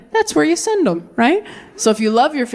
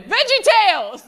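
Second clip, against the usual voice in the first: much higher panicked blurt; 11 semitones higher than usual. Retold or imitated. Put-on-the-spot audience member, panicked answer. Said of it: "panicked and went".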